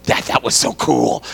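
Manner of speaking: awed whisper. Retold or imitated. Daughter reacts walking out.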